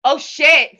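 retold shock at the heckle; startled dismay. DJ reacting to a threat from the crowd, startled. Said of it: "And I was like".

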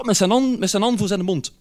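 normal talking voice

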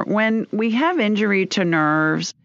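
ordinary talk, nothing marked

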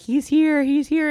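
high, thrilled announcement of a newborn; excited birth announcement. Retold or imitated. Retold announcement of the baby's birth.

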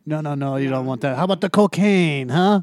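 put-on a light French accent as a beach hawker; hawking jet skis in a hint of French. Beach vendor pitching tourists, lightly French accented. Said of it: "the French guy in the Mexican beach impression".

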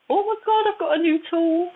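high, gleeful rush of discovery; delighted squeal. Retold or imitated. She relives discovering her new gift, squealing with delight. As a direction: squeal it like finding a treasure.

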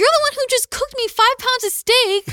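high, loud indignant outburst; exasperated retort. Retold or imitated. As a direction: fire back in exasperated disbelief. Retold argument with dad.

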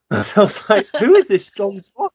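high, amused incredulity; amused bafflement. Retold or imitated. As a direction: laugh through a baffled question. Laughing while retelling own bafflement.

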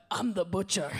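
rough stab at a Scottish butcher; badly faked Scottish accent; attempted Scottish accent. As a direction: do a shaky-at-best Scottish accent, drawn from a butcher character. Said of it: "I can't do the accent very well".

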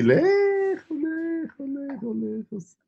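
high, slow, distant echoing call; far away voice. Shown as a demonstration. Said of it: "te escuchas como si fueras Buzz Aldrin".

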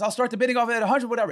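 high, fast auctioneer patter. Retold or imitated. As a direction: imitate an auctioneer opening the bidding. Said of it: "the announcer says".

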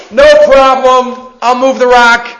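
loud, slow, easy confidence; confident hero voice. Retold or imitated. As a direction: shrug it off like it's nothing.

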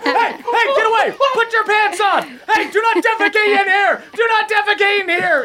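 high-pitched frantic scolding; outraged guard at a very high pitch; flustered guard. Acted. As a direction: shout orders in a high, panicked voice. Acted guard throwing kids out.

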